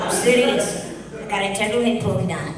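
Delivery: put-on loud, high-pitched Scottish accent; big comic Scottish outburst. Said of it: "That's my best Scottish accent".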